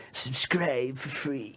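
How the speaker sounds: slow, drawn-out drunken imitation; pissed-up little gremlin. Drunk woman being recorded for a podcast, gremlin imitation. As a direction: drag it out like a tipsy gremlin. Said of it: "That's the way she says it".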